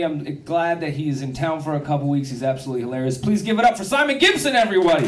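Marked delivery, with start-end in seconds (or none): 3.2-5.1 raised, loud call for applause; host's introduction. Real, not acted. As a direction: build up and hand over the stage. Host introduces next comic.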